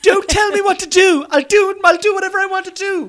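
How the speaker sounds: high, loud hammed-up tantrum; mock defiant shout. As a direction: yell it like a stroppy teenager.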